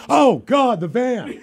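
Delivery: high, loud, laughing panic; high panicked laughing cry; stoned panic. Retold or imitated. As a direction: freak out at the word van.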